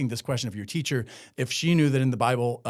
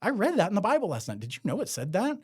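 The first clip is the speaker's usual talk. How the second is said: quick, higher-pitched mock student; student asking teacher; 6 semitones higher, faster than usual. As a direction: ask it like a curious kid in class. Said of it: "asking it as a question in class".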